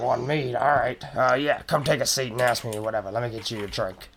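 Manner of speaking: lightly voiced, offhand goblin innkeeper; goblin barkeep. Goblin barkeep takes a drink order, lightly in character.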